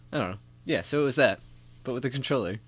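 normal talking voice

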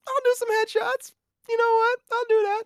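very high, loud voice quoting himself; eager self-quote. Retold or imitated. Telling his co-host how he agreed to headshots, high and eager.

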